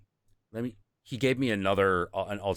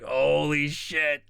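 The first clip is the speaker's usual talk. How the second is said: awestruck; 5 semitones higher, 4 dB louder, much slower than usual. Retold or imitated. Awestruck at Buddha vision.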